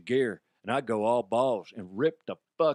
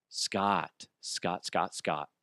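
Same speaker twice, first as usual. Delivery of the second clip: low, soft repeated name; imitating weary boss; 6 semitones lower, 5 dB quieter, much slower than usual. Boss about to correct her employee, low soft patronizing address. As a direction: drop lower and softer with each repeat. Boss gently breaks bad news.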